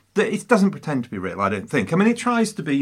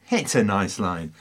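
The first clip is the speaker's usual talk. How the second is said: ordinary talk, nothing marked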